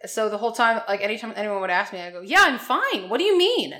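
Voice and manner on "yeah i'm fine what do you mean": higher, louder, baffled defensiveness; puzzled reply. Retold or imitated. Traveller answering an English greeting, baffled and higher. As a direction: answer as if oddly questioned.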